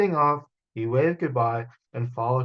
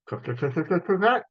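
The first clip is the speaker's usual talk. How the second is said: cracking sounds; 6 semitones higher than usual. Acted. Storyteller voices the egg cracking, rising higher.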